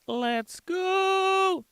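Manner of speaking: very high, drawn-out cheer; hyped shout. Real, not acted. Streamer cheering a chat name, high and hyped. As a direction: squeal it with glee. Hype call.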